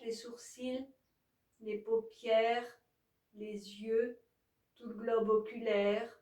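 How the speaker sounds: calm, even yoga nidra guidance; guided relaxation. Acted. Yoga teacher guiding listeners to release each body part, calm guided relaxation.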